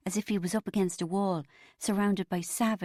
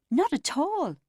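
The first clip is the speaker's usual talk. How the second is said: higher and louder, a flustered denial; stammered denial; 10 semitones higher, 5 dB louder than usual. A narrator's reading. Neil denying he is like his father, flustered stammer. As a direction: blurt it out rattled and caught off guard. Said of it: "Neil stammered".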